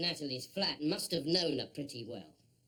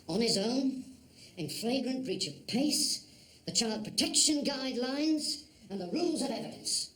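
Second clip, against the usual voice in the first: stern telling-off; 9 semitones higher than usual. Acted. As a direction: lay down the rules to the officer. Boss scolds officer.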